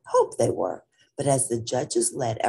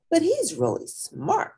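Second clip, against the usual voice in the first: Lilo's voice. Acted. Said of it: "Lilo said".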